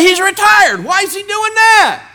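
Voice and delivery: high, loud mock protest; incredulous objector. Imagined critic questioning the retired businessman, incredulous. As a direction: squawk it like an outraged objector.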